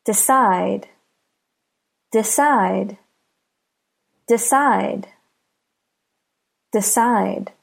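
"Decide" with CIDE stressed; stress on last syllable. Shown as a demonstration. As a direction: say "Decide" with a light DE, beat on CIDE. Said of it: "The last syllable is stressed".

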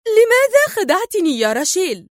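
high-pitched angry accusation; stern, heated demand; sharp, stern. A narrator's reading. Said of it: "وقالت في حدة صارمة".

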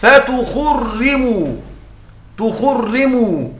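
تخرموا drawn out very slowly, with the damma held; stressed example word. Shown as a demonstration. Teacher isolating the example word تخرموا for students. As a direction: stretch out تخرموا syllable by syllable. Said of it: "الشاهد".

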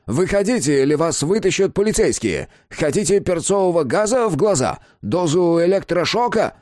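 high, pressing ultimatum; high-pitched would-be police warning; called-out threat. A narrator's reading. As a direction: threaten someone out of hiding. Threatening a man hiding under bed.

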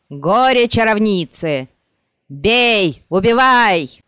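loud savage mob cries; mob yelling. Acted. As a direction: roar for blood.

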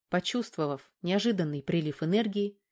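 usual speaking voice

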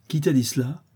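mild exclamation of surprise; startled cry. A narrator's reading. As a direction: demand it, caught off guard. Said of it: "S'écria le brigand".

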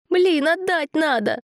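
high slow oh-no; dismayed. A narrator's reading. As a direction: exclaim in dismay. Boy remembers errand.

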